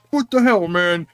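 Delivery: high-pitched mock outrage; indignant customer. Customer confronts an employee ignoring a call, indignant imitation.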